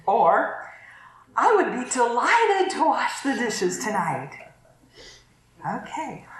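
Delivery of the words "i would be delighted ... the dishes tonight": bright, willing offer; mean it gladly; cheerful genuine offer; sincere tone. Shown as a demonstration. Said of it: "tend to hear the tone of our voice".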